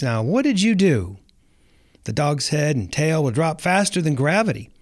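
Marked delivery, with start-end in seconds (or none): none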